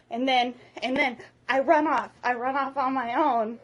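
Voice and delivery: ordinary talk, nothing marked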